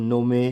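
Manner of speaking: नोमे with an extra final ay; mispronounced "gnome" as नोमे; wrong, e sounded. Shown as a demonstration. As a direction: say नोमे as no-may. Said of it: "नहीं".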